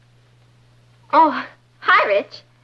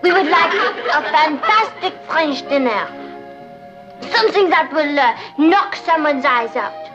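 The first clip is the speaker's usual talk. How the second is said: put-on French accent. Girl posing as French Gourmet Society by phone, French accent.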